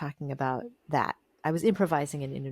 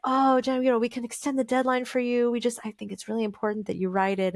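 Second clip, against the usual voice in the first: higher, kindly voice quoting John; reassuring editor; 6 semitones higher than usual. Retold or imitated. Editor reassuring her he can extend the deadline, higher and kindly. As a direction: soothe her, offer more time. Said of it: "He's like".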